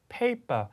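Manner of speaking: paper pitched high, ending in open "pa"; British, no R. Shown as a demonstration. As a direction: say paper without any final R. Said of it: "R 사운드만". (paper 끝 R을 빼는 영국식 발음)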